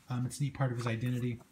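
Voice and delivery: ordinary talk, nothing marked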